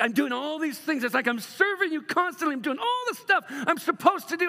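high, loud exasperated whine; complaining older son. Retold or imitated. Pastor voicing the resentful son, high exasperated whine. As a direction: whine it like a worn-out dutiful son. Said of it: "He's like".

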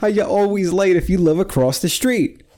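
put-on puzzled adult scolding a late kid; mock administrator. Administrators scolding chronically late Victor, mock exasperated. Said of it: "the administrators would just be like".

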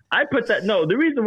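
high laughing start. Real, not acted. Laughing at the question.

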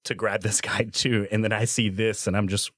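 ordinary delivery

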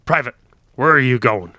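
loud shouted challenge. Acted. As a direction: call him out loudly from across the yard. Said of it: "Another voice shouted at Dlip".

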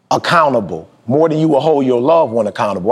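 ordinary talk

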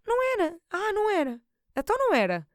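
high sarcastic scoff. Real, not acted. Sarcastic aside, high pitch.

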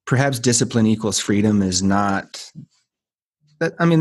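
ordinary delivery

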